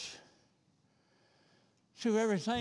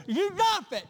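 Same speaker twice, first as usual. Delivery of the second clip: shouted high and loud, fired at the church; preaching shout; 13 semitones higher, 7 dB louder than usual. Real, not acted. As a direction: hurl it at the congregation. Preacher exhorts the congregation.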